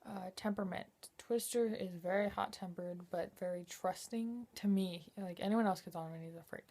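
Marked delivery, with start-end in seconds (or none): none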